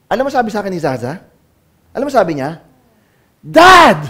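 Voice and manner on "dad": put-on child's sharp cry of Dad, higher and louder; a child shouting for her father. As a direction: call out Dad like a kid yelling across the house. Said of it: "Malakas yung sigaw".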